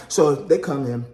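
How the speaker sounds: ordinary delivery, nothing marked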